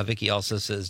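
ordinary delivery

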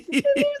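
high-pitched squealing laugh; high squeal of laughter. Real, not acted. Laughing at cat picture.

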